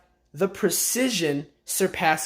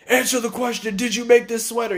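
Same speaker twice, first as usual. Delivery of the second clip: higher, raised demand; mock yell; 6 semitones higher, faster than usual. Surgeon yelling at the girl over the sweater, mock yell. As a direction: bark the question at her. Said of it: "He's all yelling and shit".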